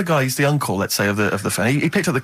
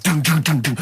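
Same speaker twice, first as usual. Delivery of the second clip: mock techno beat. Imitating thumping techno beat.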